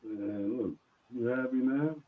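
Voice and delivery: far-off quiet voice; faint distant mic. Real, not acted. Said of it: "We, we can hardly hear you".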